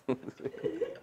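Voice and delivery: a short high burst of laughter. Real, not acted. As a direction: laugh at your own joke. Laughing at his own story.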